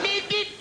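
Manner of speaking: high nasal beep like a car horn; imitated car horn beep; mock horn beep. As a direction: toot it like a horn.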